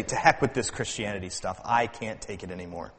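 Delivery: low, soft mock despair; understated, fading voice of someone fed up; worn-out giving up. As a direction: quietly throw in the towel, as if worked over. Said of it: "you will be so worked over".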